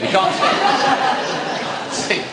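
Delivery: breathless giggle, dragged out slowly; stifled laughing aside. Real, not acted. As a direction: laugh through the words. Joke about homework reading.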